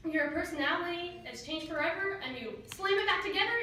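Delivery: ordinary delivery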